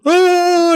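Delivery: high, loud "oh" of a thrown guy; imitated yell. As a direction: yelp like someone sent flying. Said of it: "it shows the guy go".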